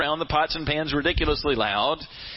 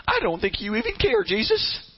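high, wounded accusation; overtaxed; 10 semitones higher than usual. Acted. Martha's heart speaking to Jesus, overtaxed and hurt. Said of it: "when Martha is overtaxed".

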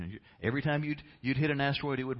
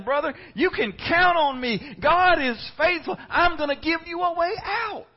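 Jesus reassuring; 11 dB louder than usual. Pretend. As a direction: proclaim it like a triumphant promise. Jesus voiced reassuring, soaring.

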